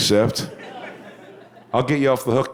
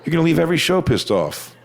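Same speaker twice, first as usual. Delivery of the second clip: usual speaking voice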